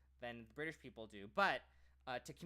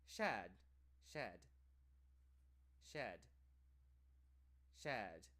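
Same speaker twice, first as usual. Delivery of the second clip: British shed; 3 semitones lower, 4 dB quieter, much slower than usual. Shown as a demonstration. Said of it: "They sound the same".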